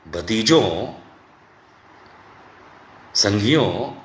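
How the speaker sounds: ordinary talk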